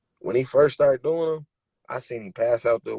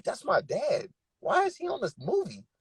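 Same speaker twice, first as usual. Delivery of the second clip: retold disbelief; 11 semitones higher, 5 dB quieter than usual. Retold shock hearing father's voice.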